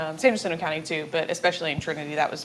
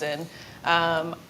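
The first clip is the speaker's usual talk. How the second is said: usual speaking voice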